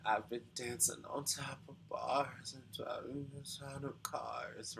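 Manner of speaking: breathy, fried whisper of the lyric; vocal fry whisper. Shown as a demonstration. Coach demonstrating the singer's vocal fry, whispered and fried. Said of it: "the vocal fry she's doing there?".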